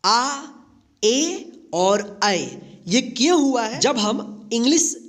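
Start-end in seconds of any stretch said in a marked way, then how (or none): 0.0-2.4 आ, ए and अय spelled out, the last drawn out slowly; three A sounds. Shown as a demonstration. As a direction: demonstrate आ, ए, अय slowly one by one. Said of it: "ए का तीन उच्चारण यहाँ पे हुआ है". (आ, ए, अय धीरे-धीरे अलग-अलग बोलें)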